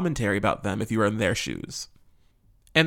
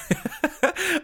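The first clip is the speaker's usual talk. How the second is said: high bright laugh. Real, not acted. Laughs after the greeting.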